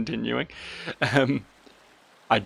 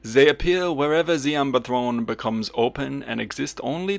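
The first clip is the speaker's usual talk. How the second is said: hammed-up French accent for a solemn character; badly faked French accent, degrading as it goes; awful French accent. Solemn bird-man explains his laws, in a bad French accent. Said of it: "French accent that honestly was just getting worse".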